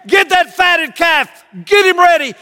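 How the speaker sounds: a father shouting for joy to his servants; booming glad command; rejoicing shout. Acted. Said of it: "as he's rejoicing".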